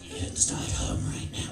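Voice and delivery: hushed, voiceless croak; whispered rasp of a creepy possessed kid; creepy possessed-child voice. Acted. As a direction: croak it out without any voice, eerily. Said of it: "You know, I did that".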